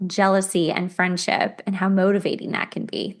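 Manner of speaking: ordinary delivery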